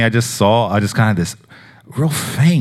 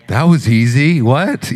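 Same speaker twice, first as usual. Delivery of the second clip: higher, louder, a stunned laugh in the voice; amazed disbelief; 5 semitones higher, 4 dB louder, slower than usual. Retold or imitated. Pastor retelling his stunned reaction to the news, amazed disbelief. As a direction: caught off guard by how easy it was.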